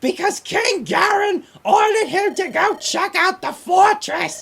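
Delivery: very high, loud, drawn-out screech; earsplitting comic villain; Gilbert Gottfried impression. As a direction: shriek every word slowly like a squawking parrot. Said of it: "he did it as Gilbert Gottfried's voice".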